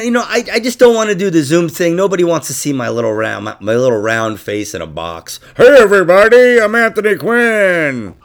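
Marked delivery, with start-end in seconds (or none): 5.6-8.1 mock showman greeting. Host imitates himself greeting a Zoom audience, hammy showman voice. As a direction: belt out a big hammy introduction.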